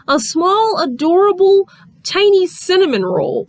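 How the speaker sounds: incredulous high-pitched mock scolding; son scolding his mom. Son telling his mother off over a cinnamon roll girl, mock-incredulous and high.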